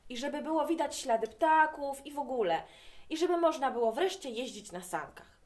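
a loud DUŻO, then easing down to eager talk; child begging Dad for lots of snow, loud then calmer; excited shout, fading. A narrator's reading. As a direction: shout it, then settle back. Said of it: "zawołałem".

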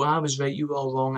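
ordinary talk, nothing marked

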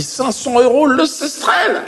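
proud announcement. Retold or imitated. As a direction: savour the price like a salesman. Alien gives the rate. (l'extraterrestre annonce le taux, aigu et lent)

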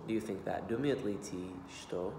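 ordinary talk, nothing marked

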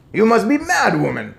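high, loud outburst; indignant cry. A narrator's reading. Father calls grandmother mad, crying out. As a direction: cry out in disbelief and anger. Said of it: "cried Mister Jenkins".

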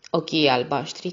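usual speaking voice

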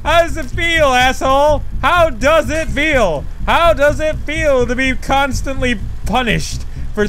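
high, emphatic jeering; vengeful taunting. Real, not acted. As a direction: crow over a beaten tormentor. Vengeful taunts at game enemy.

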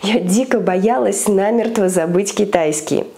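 ordinary delivery

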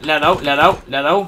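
loud urgent callout; shouting alarm. Real, not acted. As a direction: yell it as a teammate goes down. Teammate hit, shouting.

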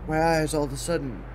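high, strained voice after a yawn; teary-eyed from a yawn. Real, not acted. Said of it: "I'm crying".

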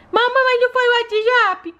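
put-on high childlike voice of a small daughter; little girl voice. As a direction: play the sick little girl talking to mom. Doll interrupts story.

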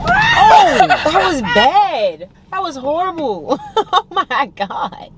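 high, slow, laughing cringe; laughing disbelief. Real, not acted. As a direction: cringe out loud while laughing. Reacting to his gasp bit.

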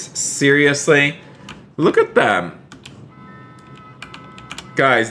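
ordinary delivery

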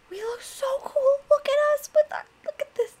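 high-pitched delighted squeal; real squeaky excitement; excited gushing. Real, not acted. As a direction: gush in a tiny, very high voice. Gushing over the new gear.

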